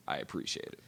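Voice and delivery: whispered, mock-grateful thank-you. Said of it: "you fucking be thankful and say".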